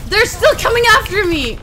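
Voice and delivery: high, loud panicked cry. Real, not acted. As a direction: shout in panic while chased. Chased by enemies.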